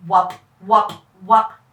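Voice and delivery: loud, low rotor chop; helicopter whop. Acted. Rotor noise as the helicopter starts, loud and low. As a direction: thump it like blades.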